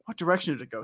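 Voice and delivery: normal talking voice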